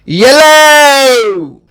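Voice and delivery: yellow, stretched out slowly with a clear ye sound; slow model word. Shown as a demonstration. Teacher modelling یلو slowly for the class. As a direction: say یلو slowly, syllable by syllable, for learners. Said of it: "تلفظ".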